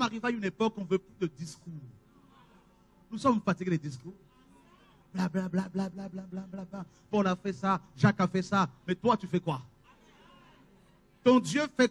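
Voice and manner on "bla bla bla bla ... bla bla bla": mocking bla bla. Mocking endless talk.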